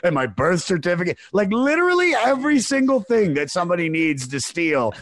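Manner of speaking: high, loud imitation of an upset date; mock crying. Upset date listing what was in the bag, mock crying. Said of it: "she's, like, crying".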